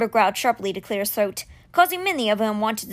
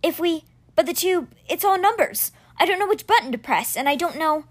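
higher, rushed and worried; flustered boy Alex; 6 semitones higher than usual. Acted. Boy fretting over which button to press, flustered. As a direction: stumble over the numbers in a panic.

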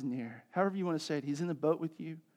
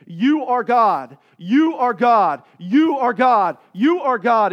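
loud, slow, repeated declaration; emphatic shouting; 5 semitones higher, 15 dB louder, slower than usual. Retold or imitated. Preacher re-enacting worship shouts to God, emphatic shouting. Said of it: "I found myself emphatically shouting over and over again".

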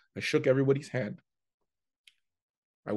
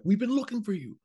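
mock chorus; 10 semitones higher, faster than usual. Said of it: "everybody's like".

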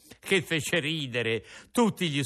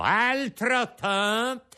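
slow, high, loud ringmaster call; shouted command; 8 semitones higher, 5 dB louder, much slower than usual. Acted. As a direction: bellow it across the circus ring. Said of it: "il direttore gridò".

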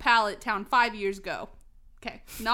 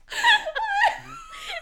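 squealing breathless laughter; helpless high laugh. Real, not acted. Reading the absurd fic, she squeals with laughter. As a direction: lose it completely, high and squealing.

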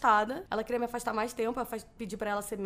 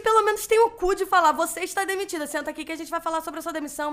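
much higher and louder, outraged; indignant retort; 6 semitones higher, 9 dB louder than usual. Retold or imitated. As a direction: let the outrage burst out at the boss. Imagined indignant retort to boss. (retrucando indignada, bem mais alto)

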